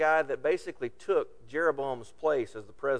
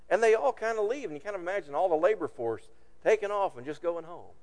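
normal talking voice

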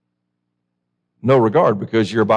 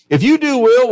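high and louder on if you do well; emphatic; 10 semitones higher, 4 dB louder than usual. Acted. Preacher rereading God's promise, high and louder.

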